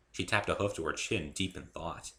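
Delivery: usual speaking voice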